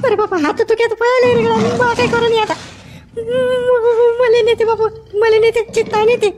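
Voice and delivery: frantic high-pitched panic; terrified cries. Acted. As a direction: scream as if the cheetah is dragging you off. Cheetah attack, terrified cries.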